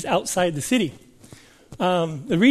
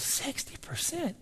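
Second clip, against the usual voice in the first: hushed, high, slow disbelief; devastated; 7 semitones higher, 14 dB quieter, slower than usual. Retold or imitated. Minister hearing he falls short, devastated and hushed. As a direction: echo it faintly, as if crushed. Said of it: "oh, he was devastated".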